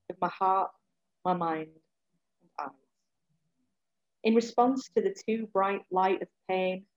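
measured spoken word delivery; poem reading. Acted. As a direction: read it as a poem, with weight. Reads her poem aloud.

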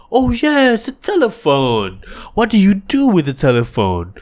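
high, loud chirpy host voice; chirpy cartoon host. A kids' show host quizzing the sidekick, high and chirpy. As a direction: bounce it like a kids' TV presenter.

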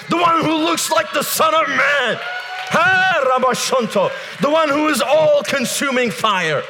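high-pitched, shouted revival preaching; fervent shouted proclamation, pitched high; full-force preaching. Real, not acted. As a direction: preach at full cry, phrase by phrase.

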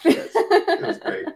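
high laughing cheer; laughing praise. Real, not acted. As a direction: laugh it out happily.

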